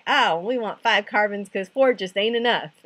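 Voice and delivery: loud, hammed-up Texas twang; bad Texas accent. Teacher joking that Texans want everything bigger, bad Texas accent. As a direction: put on a badly faked Texas accent, loudly. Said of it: "that's a really bad accent".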